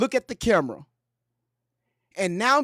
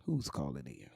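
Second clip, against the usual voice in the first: much lower, softer smooth radio host; late night radio DJ voice; 18 dB quieter than usual. Retold or imitated. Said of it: "them late night radios when they be talking like this".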